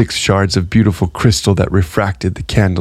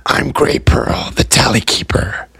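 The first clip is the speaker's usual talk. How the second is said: mysterious Tally Keeper; 4 semitones higher than usual. A narrator's reading. Gray introduces himself to Amory.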